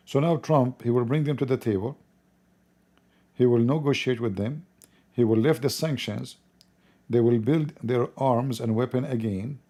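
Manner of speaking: normal talking voice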